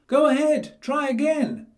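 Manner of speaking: high, loud taunting piglet voice; cheeky piglet. A narrator's reading. Percy taunting the wolf from inside, high and loud. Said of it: "said Percy".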